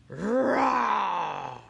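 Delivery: loud, drawn-out roar; tiger roar. Acted. Tiger hologram startles the children, loud roar. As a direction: roar out like a big cat.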